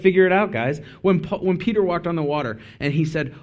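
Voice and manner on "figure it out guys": dismissive brush-off. Retold or imitated. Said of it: "to just be like".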